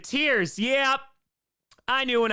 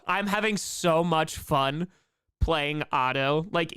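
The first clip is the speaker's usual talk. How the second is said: ordinary talk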